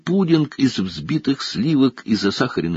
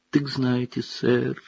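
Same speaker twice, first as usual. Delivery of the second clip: sad; 3 semitones higher, 6 dB quieter, slower than usual. A narrator's reading. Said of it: "печально проговорил эльф".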